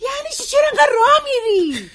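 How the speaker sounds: high, strained complaint; exasperated outburst. Acted. Wife to pacing husband, exasperated outburst.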